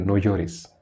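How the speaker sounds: understated hushed reassurance; Jesus's gentle command. Acted. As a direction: console quietly as Jesus. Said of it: "él le dijo a la mujer".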